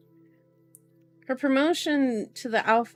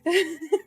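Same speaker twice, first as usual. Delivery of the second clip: high-pitched giggling, breaking the reading; real, helpless giggle; 7 semitones higher, 3 dB louder than usual. Real, not acted. Reader cracks up at the dad's name, giggling.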